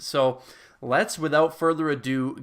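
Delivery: ordinary talk, nothing marked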